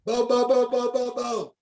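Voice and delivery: high squawking bullhorn noise, voiced for fun; mock megaphone squawk. Imitating the megaphone while calling to a shooter, high squawk. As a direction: make the noise of a crackling megaphone.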